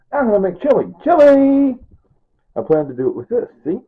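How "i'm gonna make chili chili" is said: higher and louder, a proud shout of Chili; excited exclaim. Real, not acted. Cooking vlogger to viewers, excited shout. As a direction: announce the dish with a big burst.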